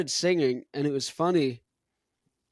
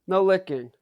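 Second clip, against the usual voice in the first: scolding dog; 5 semitones higher, 4 dB louder than usual. Real, not acted.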